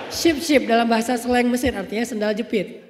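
ordinary talk, nothing marked

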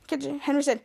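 ordinary delivery, nothing marked

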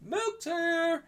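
high sing-out call; imitated milkman. Co-host retelling the clip, high milkman's call. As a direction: call from the door in a high, soft voice. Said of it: "and you hear".

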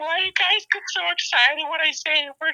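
doing Carl for friends, high put-on voice. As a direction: go way up into the character's voice. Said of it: "you sound exactly like him".

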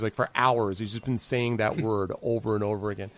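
normal talking voice

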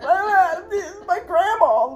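high, loud panicked wail; crying out in hammed-up alarm; retold freak-out. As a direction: reenact jumping out of your skin. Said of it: "I about jumped out of my fucking skin".

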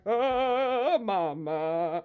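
loud, hammed-up Italian wail; cartoon Italian. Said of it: "a cartoon Italian".

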